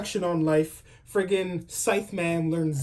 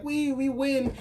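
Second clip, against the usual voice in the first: mock cheer; 9 semitones higher, much slower than usual. Voices everyone celebrating the win.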